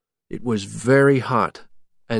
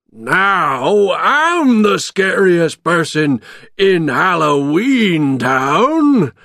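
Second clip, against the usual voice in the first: loud, drawn-out gloating; gleeful bogeyman boast; happy shouting; 7 semitones higher, 6 dB louder, much slower than usual. A narrator's reading. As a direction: crow in triumph like a villain. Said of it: "he shouted happily".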